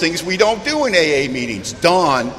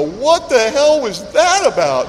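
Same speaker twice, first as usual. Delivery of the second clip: slow, higher baffled puppy voice; puppy's confusion; 6 semitones higher, slower than usual. Retold or imitated. Puppy set down on the lawn, slow baffled voice. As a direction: drawl it like a confused dog. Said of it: "he'll look at you with all honesty and go".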